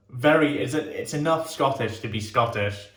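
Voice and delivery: ordinary talk, nothing marked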